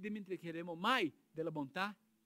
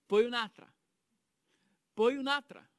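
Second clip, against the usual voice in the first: conteggio enfatico; 4 semitones higher, 8 dB louder than usual. Acted. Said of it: "sto contando, eh?".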